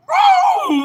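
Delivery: loud, very high "woo"; excited whoop. Real, not acted. Streamer reacting to a favourite saying, excited whoop.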